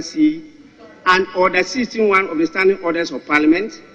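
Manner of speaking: loud, measured formal pronouncement; formal ruling. Real, not acted. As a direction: announce the ruling solemnly to the House.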